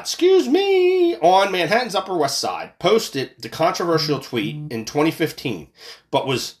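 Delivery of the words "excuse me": startled excuse me. Real, not acted. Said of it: "oh my God".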